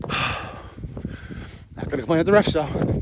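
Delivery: slow, whispered under the breath; breathy whisper. Real, not acted. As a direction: sigh it out in a whisper. Sighing, whispered aside.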